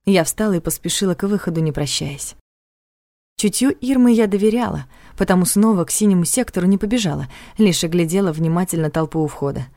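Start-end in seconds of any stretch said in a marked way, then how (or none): none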